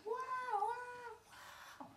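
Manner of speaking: high squealing screams from far off; distant screams. Retold or imitated. Pizza guy mimicking distant screaming girls, high squeal.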